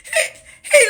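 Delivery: high, loud laugh-cry through the words; laughing hard. Real, not acted. Laughing to viewers about the officer's look. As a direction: crack up so hard it squeaks.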